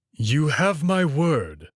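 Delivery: slow, weighty promise from the wolf; solemn wolf vow. Acted. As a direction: give your word slowly, like an oath.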